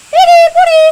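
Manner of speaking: high, loud call to the dogs. Real, not acted. Calling out high and loud to her dogs.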